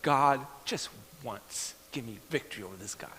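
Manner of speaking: quiet muttered plea to God, dropping low; soft private prayer; hushed prayer. Retold or imitated. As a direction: pray it under your breath. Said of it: "I prayed".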